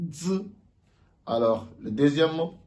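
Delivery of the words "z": Z said like the Chinese z; Z as the Chinese letter z; Chinese z answer. Shown as a demonstration. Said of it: "la bonne réponse".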